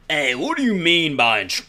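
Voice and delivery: put-on loud cop with a Southern accent. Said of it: "most blatantly racist cop voice I can think of".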